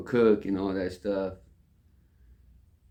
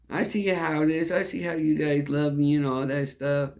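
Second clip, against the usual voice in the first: a higher, hurt-sounding mock of the wife; wife's sulky reply; 7 semitones higher than usual. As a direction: play her put-out comeback, pitched up. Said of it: "and she said".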